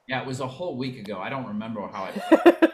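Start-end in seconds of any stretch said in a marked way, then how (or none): 2.1-2.7 laughing. Real, not acted. Joking about forgetting last week.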